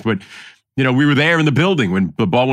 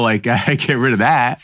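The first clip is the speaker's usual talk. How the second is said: normal talking voice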